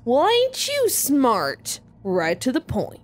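slow, lazy Southern drawl; the villain mocks her in a slow Southern drawl. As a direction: savor each word like a smug Southern heavy. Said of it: "I'm making him Southern".

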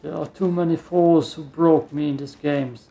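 ordinary talk, nothing marked

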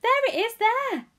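high, loud excited pointing-out; eager, high-pitched cry; shout. A narrator's reading. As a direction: shout it across the crowd. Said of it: "somebody else shouted".

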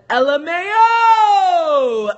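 loud, high, very slow spelled-out LMAO; drawn-out mock laugh. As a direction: stretch the letters out to taunt him. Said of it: "I said".

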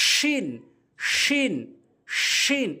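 "শিন" with a long hushing sh from mid-tongue; শিন demonstration. Shown as a demonstration. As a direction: model "শিন", tongue middle near the palate. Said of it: "এভাবে আমরা উচ্চারণ করব". ("শিন", জিহ্বার মাঝখান তালুর দিকে)

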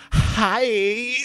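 very high, loud, drawn-out HI; squealed flirty HI with a sigh; flirtier. Shown as a demonstration. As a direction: push the flirting over the top on HI. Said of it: "I can say that more flirtily".

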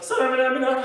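high, loud pop-singer impression. Pop star belting a lyric, high put-on voice.

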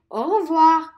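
"Au revoir" with French vowels; teacher modelling "Au revoir"; French pronunciation. Shown as a demonstration. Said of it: "you can say".

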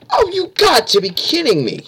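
high exasperated cry. Real, not acted. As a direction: cry out in disbelief. Caught again, exasperated.

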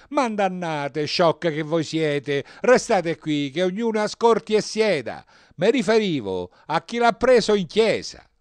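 high, very loud scolding; shouting priest. A narrator's reading. Priest stopping the women walking out, shouting. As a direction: yell at the whole congregation, exasperated. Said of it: "gridò il prete".

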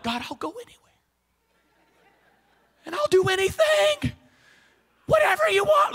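high-pitched, squeaky pledge to God; hammed-up vow rising into a very high voice; mock fervent declarations. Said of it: "making all these declarations to God".

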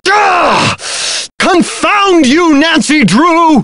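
high, slow startled cry; startled outburst. Acted. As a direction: jump and cry out in shock.

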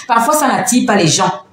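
ordinary talk, nothing marked

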